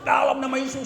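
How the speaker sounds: high, drawn-up exorcism command; forceful rebuke. Retold or imitated. Preacher reenacting his attack on the demon, forceful rebuke. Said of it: "saya mulai hajar terus".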